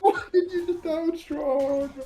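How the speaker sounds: slow, high, drawn-out mock wail; mock anguished wail. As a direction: wail the question in pretend despair. Caster repeats the question dramatically.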